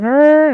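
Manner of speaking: high, loud drawn "arr"; mock roar. Gamer rolling on a giant streak, mock roar. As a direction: let out a high play growl.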